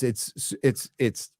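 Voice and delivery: ordinary delivery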